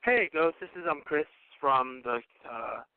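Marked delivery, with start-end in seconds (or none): none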